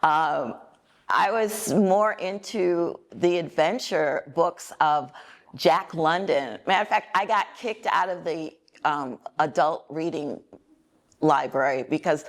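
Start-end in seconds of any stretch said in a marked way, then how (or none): none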